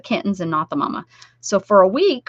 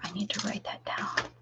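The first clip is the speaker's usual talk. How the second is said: whisper; 14 dB quieter than usual. Real, not acted. Muttering a note to herself.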